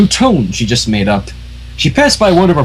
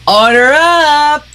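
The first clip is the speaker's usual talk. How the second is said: high, slow sing-song call; high drawn-out shout of the order; Pinkie calling out; 12 semitones higher, 3 dB louder, much slower than usual. Acted. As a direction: sing out the order like a cheery pony.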